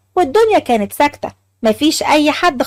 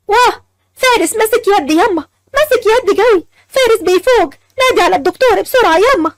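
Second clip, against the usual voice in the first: high, urgent and breathless with joy; excited cry; 7 semitones higher than usual. Acted. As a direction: gasp it out, he's waking up. Wife sees husband grab hand.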